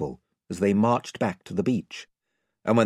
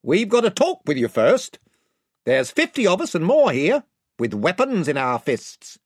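raised voice of a gang chief; 8 semitones higher, 5 dB louder than usual. A narrator's reading. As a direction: warn them off as spokesman, pitched up. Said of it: "it said".

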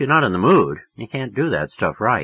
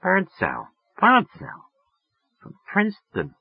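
musing repetition, voice lifting high; mulling it over; 10 semitones higher than usual. A narrator's reading. Woman trying to place a name, musing. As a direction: try the name over, piece by piece. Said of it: "running the name over in her mind".

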